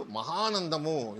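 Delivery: ordinary delivery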